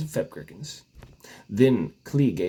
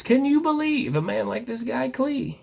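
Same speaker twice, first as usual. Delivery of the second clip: amazed emperor; 11 semitones higher than usual. Acted. Emperor exclaims to servants.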